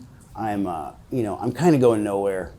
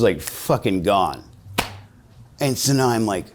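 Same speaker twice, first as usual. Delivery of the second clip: ordinary delivery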